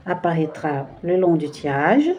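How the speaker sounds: usual speaking voice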